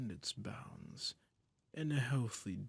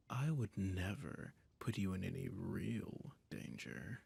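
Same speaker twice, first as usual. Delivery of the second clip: doting emperor. Acted. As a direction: purr control over a frightened pet. Holds a doting, domineering emperor role.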